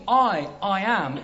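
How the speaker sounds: "I, I am" said slowly with a doubled, weighted I; emphatic I, I am. Shown as a demonstration. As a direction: lean on the repeated "I" as you slow down. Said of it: "emphasis in each of the statements".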